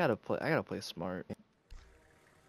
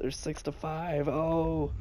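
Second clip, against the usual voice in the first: usual speaking voice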